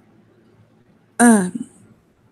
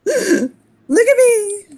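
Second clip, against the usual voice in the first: high, gleeful show-off voice; 12 semitones higher, 4 dB louder, slower than usual. Acted. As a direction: present yourself in a high singing-out voice. Character spreads arms, showing off.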